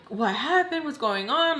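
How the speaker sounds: high, alarmed mock questions; freaking out. Walking in on the crying, a startled high-pitched question. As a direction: burst in, suddenly alarmed. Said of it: "she's freaking out".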